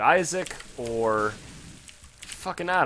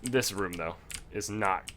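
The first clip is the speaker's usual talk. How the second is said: normal talking voice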